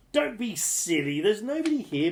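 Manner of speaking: lightly voiced scolding giantess; a hint of a scolding wife. A narrator's reading. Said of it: "the giantess scolded".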